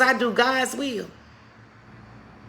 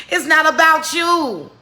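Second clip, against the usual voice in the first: higher, louder and slow; emphatic proclamation; 7 semitones higher, 11 dB louder than usual. Acted. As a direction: proclaim "It's not about you" as the point. Sermon climax.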